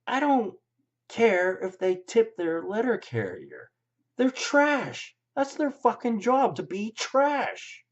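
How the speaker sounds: high, loud furious rant; angry rant. Real, not acted. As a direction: shout it out in disgust. Ranting about hated people.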